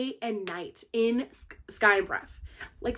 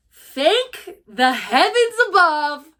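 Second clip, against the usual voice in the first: mock heavenly thanks; 5 semitones higher, 6 dB louder, much slower than usual. Real, not acted. Mock prayerful gratitude.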